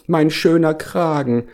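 high-pitched whiny lament; wailing lament. A narrator's reading. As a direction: wail it high, like a vain bear in distress. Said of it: "jammerte Hieronymus Kragenpeter".

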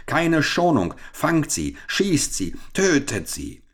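high-pitched, punched short commands; furious orders. Acted. Cheated captain ordering his men to kill, furious. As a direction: drive them on in a rage. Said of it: "brüllte der Geprellte rasend vor Wut".

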